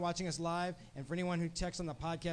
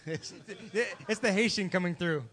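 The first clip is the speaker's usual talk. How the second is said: Haitian accent; 6 dB louder, slower than usual. Real, not acted. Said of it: "I have an accent".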